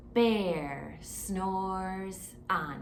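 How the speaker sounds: very slow refrain to repeat; slow call-along refrain. Shown as a demonstration. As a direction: lead the children slowly. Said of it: "Can you say that with me".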